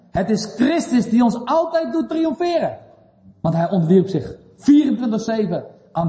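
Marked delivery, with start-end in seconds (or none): 0.1-2.8 high, triumphant proclamation; emphatic preaching. Real, not acted. Preacher proclaiming Christ's triumph to the congregation, emphatic preaching.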